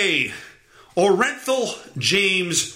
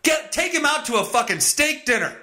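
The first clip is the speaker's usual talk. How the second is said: ordinary talk, nothing marked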